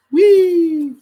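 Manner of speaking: high, loud, drawn-out whee; playful whee. Pretend. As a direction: squeal a long playful whee. Playfully squirting hot glue.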